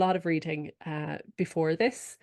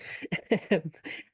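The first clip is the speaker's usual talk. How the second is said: breathy laugh. Real, not acted. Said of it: "I can't even say it without laughing".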